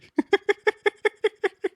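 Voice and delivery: high squeaky giggle; high squealing laugh. Real, not acted. Laughs after the voiced plea.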